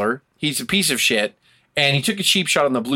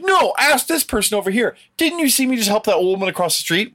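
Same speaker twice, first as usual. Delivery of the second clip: much higher, whiny put-on voice; mock innocent hypocrite; 9 semitones higher than usual. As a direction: whine like a fraud pointing to his alibi. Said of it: "they're like".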